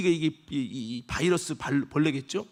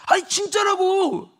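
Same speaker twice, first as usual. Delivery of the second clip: insisting maid; 10 semitones higher, slower than usual. Retold or imitated.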